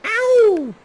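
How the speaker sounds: very high, loud yelp of pain; sharp ow. Real, not acted. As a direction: yelp as if hurt. Sudden pain cry.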